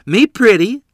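put-on high, loud childlike squeal; little girl voice. Little sister at the mirror, high and loud little girl voice. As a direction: play a tiny girl delighted with herself. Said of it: "Lorene said".

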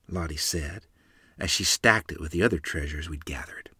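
usual speaking voice

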